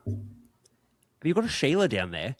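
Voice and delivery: put-on slow, high Australian accent; a subtle Aussie accent, drawn out slowly. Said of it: "Do you wanna say it again?".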